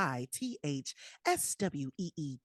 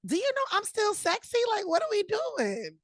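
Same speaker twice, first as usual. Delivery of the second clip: indignant; 12 semitones higher, 3 dB louder than usual. Real, not acted. Indignant about needing readers.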